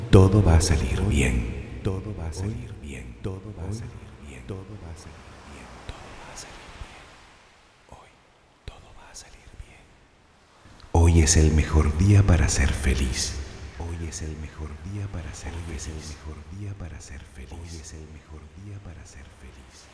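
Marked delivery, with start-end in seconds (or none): 1.8-9.8 distant, very soft repeat of an affirmation; fading echo. Acted. 13.8-19.9 distant, very soft repeat of an affirmation; fading echo. Acted.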